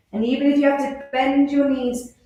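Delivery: normal talking voice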